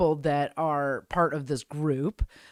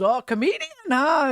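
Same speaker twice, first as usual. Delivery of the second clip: higher, louder teasing reply; playful mock-impressed pirate; pirate host; 11 semitones higher, 5 dB louder than usual. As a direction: imitate an impressed stage host. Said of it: "And he goes".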